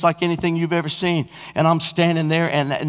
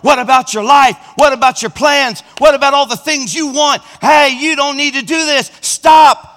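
loud, high pleading devil's voice; tempting voice; 9 semitones higher, 7 dB louder than usual. Retold or imitated. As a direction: voice the devil begging him to stay.

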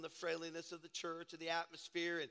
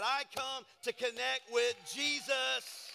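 louder, slower, higher proclamation; preaching emphasis; 6 semitones higher, 7 dB louder, slower than usual. Real, not acted. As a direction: drive it home from the pulpit. Repeated preaching line, slow emphasis.

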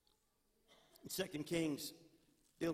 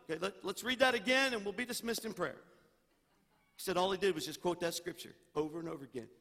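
usual speaking voice

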